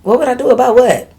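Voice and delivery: high-pitched baffled comeback; exasperated, pitched well up; incredulous. Real, not acted. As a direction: throw the question back in disbelief. Baffled by the letter's question.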